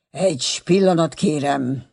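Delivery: ordinary delivery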